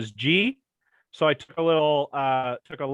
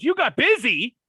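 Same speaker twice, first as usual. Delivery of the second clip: mock indignation; 9 semitones higher, 5 dB louder than usual. Rock mocking the Coach.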